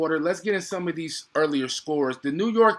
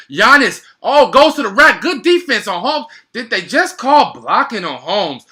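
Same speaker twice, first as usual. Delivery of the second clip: big play-by-play roar; excited call; 6 semitones higher, 11 dB louder than usual. Real, not acted. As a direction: shout it like the crowd just rose.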